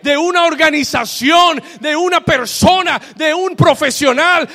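gritado, fuerte y enfático; predicación a todo pulmón. Acted. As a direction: predica gritando con convicción. Preaching at full force. (predicador gritando a la congregación)

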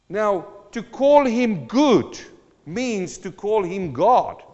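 slow, loud, weighty; emphatic preaching. Acted. Preacher driving home that good means God, slow and loud. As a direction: land each word like a verdict.

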